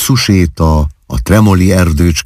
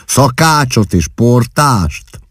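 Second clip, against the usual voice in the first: higher and slow, mock surprise; teasing slow question; 7 semitones higher, slower than usual. A narrator's reading. Husband mocking the idea of servants, slow and teasing. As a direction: drag the words out teasingly. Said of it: "pajkos gúnnyal".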